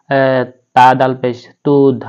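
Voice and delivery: ordinary delivery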